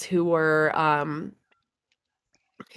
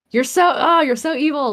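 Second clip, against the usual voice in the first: mock accusation; 8 semitones higher, 4 dB louder than usual. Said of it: "as a joke".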